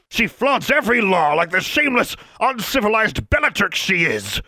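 bellowing. Acted. Inspector denounces the schoolgirl, bellowing in outrage. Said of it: "bellowed Mister Brown".

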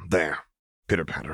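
ordinary delivery, nothing marked

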